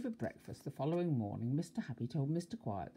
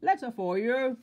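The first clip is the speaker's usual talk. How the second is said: loud, high hollered greeting; booming delivery call; postman's shout; 8 semitones higher, 9 dB louder than usual. A narrator's reading. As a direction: shout it cheerily at the door. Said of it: "shouted the postman".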